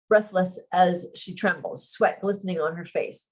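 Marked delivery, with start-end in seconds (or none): none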